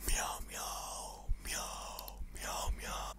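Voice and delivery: soft, voiceless cat meows; whispered meowing. Acted. Body calling out for you, whispered meows. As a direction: meow repeatedly under the breath.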